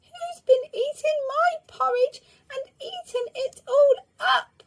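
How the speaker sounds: very high, loud little bear squeak; the little bear squeaking about his eaten porridge; tiny squeaky voice. A narrator's reading. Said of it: "tiny, squeaky voice said".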